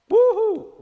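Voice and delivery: a high, loud sarcastic woohoo; mock cheer. Real, not acted. Mocking a culture that frowns in public, sarcastic loud cheer.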